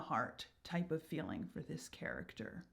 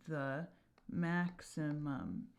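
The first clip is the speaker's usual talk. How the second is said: slow added word; 4 semitones lower, 7 dB quieter, much slower than usual. Shown as a demonstration. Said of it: "add a word here".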